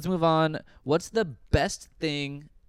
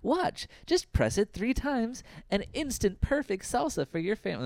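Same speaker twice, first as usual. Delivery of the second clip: infomercial pitch; 9 semitones higher than usual. Retold or imitated. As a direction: sell it like a cheesy TV ad host. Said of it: "And they're like".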